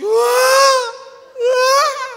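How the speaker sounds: two loud, high mock screams of a woman giving birth; hammed-up labor scream. Preacher imitating his wife screaming in labor at the hospital, loud high mock scream. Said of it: "Y aquella gritando así".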